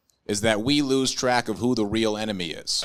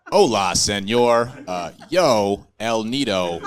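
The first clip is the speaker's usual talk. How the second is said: loud, slow, clumsy tourist Spanish; a lost gringo trying his few words of Spanish, loudly; bad Spanish; 5 dB louder, slower than usual. Tourist with broken glasses asking strangers in Mexico, loud broken Spanish. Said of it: "in Mexico and I didn't know Spanish".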